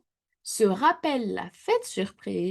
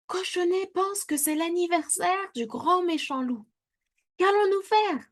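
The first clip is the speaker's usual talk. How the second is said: a higher, anxious mother's voice; worried mama pig; 10 semitones higher than usual. A narrator's reading. Maman Tire-Bouchon to papa, worried, in a higher voice. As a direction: fret to your husband in a raised voice.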